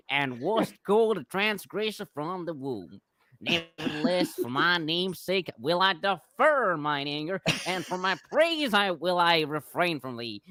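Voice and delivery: hammed-up American accent, slow and loud; a bad American twang reading old verse; horrible American accent. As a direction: read scripture in an over-the-top American accent. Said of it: "for my horrible American, uh, uh, pronunciation".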